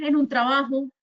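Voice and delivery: normal talking voice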